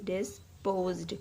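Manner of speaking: डिस्पोज्ड said slowly and softly, ending zd; slow soft demo word. Shown as a demonstration. Teacher slowly sounding out a word, soft and drawn out. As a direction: draw out डिस्पोज्ड for the students. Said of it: "जहाँ पे जेड डी की sound आ रही है".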